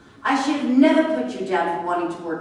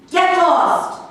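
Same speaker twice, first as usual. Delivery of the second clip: loud, higher "get lost"; angry shout; 7 semitones higher, 7 dB louder than usual. Acted. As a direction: bark them out the door. Throwing visitors out.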